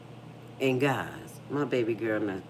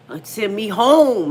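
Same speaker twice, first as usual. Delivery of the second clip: whiny demand; 8 semitones higher, 13 dB louder, slower than usual. Retold or imitated. Daughter quoted demanding to leave.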